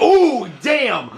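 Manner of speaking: sudden high hype yell; excited. Real, not acted. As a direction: react to a big hit. Charizard's surprise kill in commentary.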